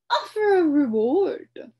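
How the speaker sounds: slow, high, loud bug voice; offering. A narrator's reading. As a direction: offer it eagerly. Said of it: "offered the bug again".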